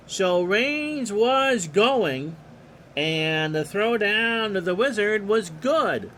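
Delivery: steady announcer call of the action; play-by-play. Acted. Announcer calling the pitch to the listeners, play-by-play. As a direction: call the game like a radio announcer.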